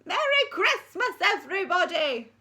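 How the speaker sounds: put-on high, quavering old lady asks; old headmistress inquiring. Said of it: "announced Miss Stint over the din".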